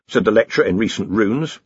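usual speaking voice

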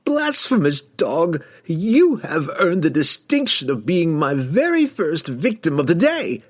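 high-pitched, raging villain threat; outraged wizard passing sentence; Kessel's outraged scream. A narrator's reading. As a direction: condemn your enemy in a high, theatrical fury. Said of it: "he screamed at Drizzt".

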